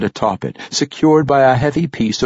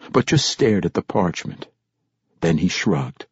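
ordinary delivery

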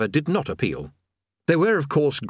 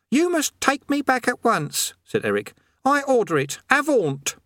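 put-on high young voice giving orders; imperious boy; 14 semitones higher than usual. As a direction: order him back like a petulant boy. Eric commands.

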